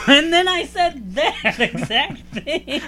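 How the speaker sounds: high, slow, laughing through the words. Real, not acted. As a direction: crack up mid-sentence while retelling. Laughing at friend's blunder.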